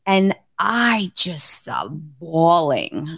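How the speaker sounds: ordinary talk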